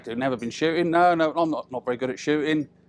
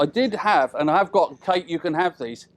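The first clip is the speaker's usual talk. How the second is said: usual speaking voice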